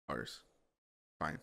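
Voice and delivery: defeated sigh. Retold or imitated. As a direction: mumble it in defeat. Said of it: "And I'm like".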